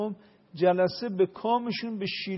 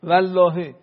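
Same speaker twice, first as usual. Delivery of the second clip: repeated swearing, the last one shouted; insistent oath; 9 dB louder, slower than usual. Shown as a demonstration. Explaining Hussein's oath: mounting loud vow. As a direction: build the oath until it bursts out. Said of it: "یعنی والله".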